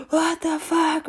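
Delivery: high, drawn-out mock shriek; gasp, then screech in outrage; hammed-up furious reaction; fiery outburst. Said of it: "my natural reaction was to be like".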